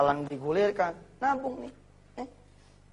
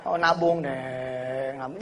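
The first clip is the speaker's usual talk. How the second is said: mocking drawl; 5 semitones lower, much slower than usual. Mocking the old saving way.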